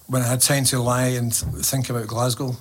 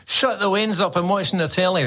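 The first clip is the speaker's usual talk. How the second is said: mock exasperated call; 6 semitones higher than usual. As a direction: playfully call out like a parent shushing noisy kids. Acted parent complaining about noisy kids.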